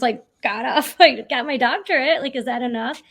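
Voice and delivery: mock exasperation, half laughing; exasperated retort. New doctor answering dad's marriage question, exasperated and laughing. Retold exasperated reply to dad.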